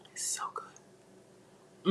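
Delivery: very slow, hushed whisper; savoring whisper. Real, not acted. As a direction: drag it out in a whisper.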